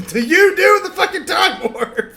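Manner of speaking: high, half-laughed exclamation; laughing outburst. Acted. Player heckles about the time warp, exclaiming. Mock-exasperated outburst before the musical number.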